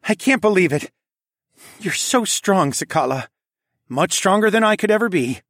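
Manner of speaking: emotional fatherly voice; proud father voice. A narrator's reading. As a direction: praise her with tears close. Father moved by daughter.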